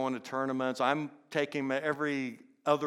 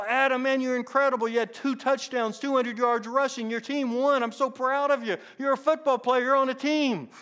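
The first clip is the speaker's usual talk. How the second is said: much higher, louder, beaming congratulations; proud praise; 11 semitones higher, 8 dB louder than usual. Retold or imitated. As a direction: gush with pride at the kid. Teacher praises boy after game.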